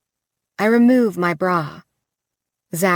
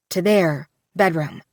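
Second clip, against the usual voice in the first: ordinary delivery, nothing marked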